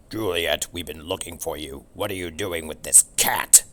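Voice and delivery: loud, gruff big dog; howling dog. Acted. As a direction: growl it like an angry dog. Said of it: "he howled".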